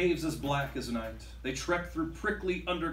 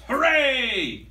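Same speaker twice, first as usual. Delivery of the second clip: loud, high hooray; triumphant pirate captain cheering; captain's shout; 8 semitones higher, 8 dB louder than usual. Acted. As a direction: shout it to the whole crew. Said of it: "The captain shouts".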